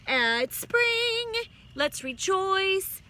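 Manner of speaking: high, loud, slow proclamation; announcing voice. Retold or imitated. Reader voicing the book's cheer about spring, high, loud and slow. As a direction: declare it grandly. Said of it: "They said".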